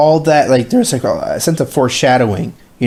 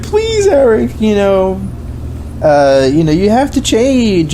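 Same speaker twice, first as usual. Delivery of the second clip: mock mother pleading; 8 semitones higher, slower than usual. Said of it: "she's like".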